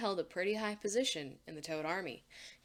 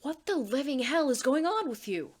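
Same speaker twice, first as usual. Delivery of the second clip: loud, higher, fed-up shout; exasperated yell; 6 semitones higher, 8 dB louder than usual. Acted. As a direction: demand an answer, angry. Anne whirls on Marcy, angry.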